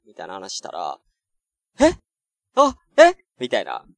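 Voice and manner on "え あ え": high startled "え？" repeated, louder; flustered surprise. Retold or imitated. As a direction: caught off guard, stammering. Retold flustered reaction. (驚いて戸惑う高い声)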